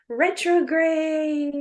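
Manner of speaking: drawn-out, high singsong announcement; mock enthusiasm. Real, not acted. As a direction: stretch the word out high in fake cheer. Drawn-out mock-cheery topic reveal.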